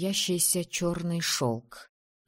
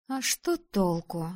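slow, mildly grumbled question; grumbling; slower than usual. A narrator's reading. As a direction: grumble it out, dragging the words. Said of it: "проворчала она". (ворчливо, с растяжкой)

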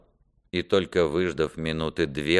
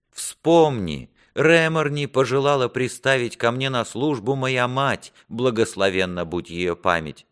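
higher-pitched, impassioned plea; pleading prince; 6 semitones higher than usual. Acted. Prince begs his father to spare a servant, impassioned. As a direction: beg mercy for someone else with fervour.

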